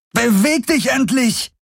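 very high-pitched frustrated yell; angry scream. A narrator's reading. Kirai orders her mount to move, screaming. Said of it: "schrie sie".

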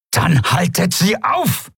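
high, slow, forceful order; imperious snapping. A narrator's reading. Monaru rebuking the guardsman, high imperious command. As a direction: order the guard sharply to act. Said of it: "herrschte Monaru ihn an".

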